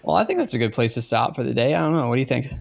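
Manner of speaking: ordinary delivery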